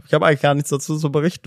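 ordinary talk

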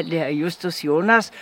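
ordinary delivery

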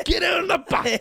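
high, loud barked command; hammed-up action film shout; action hero shout. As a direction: yell it like a movie commando. Imitating action movie shout.

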